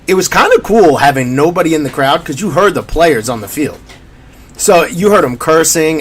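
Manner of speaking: ordinary talk, nothing marked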